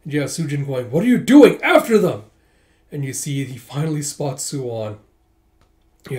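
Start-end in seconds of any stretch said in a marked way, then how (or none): 0.9-2.2 much higher, much louder angry yell; furious order. Acted. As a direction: scream at the troops to pursue. General screaming at troops.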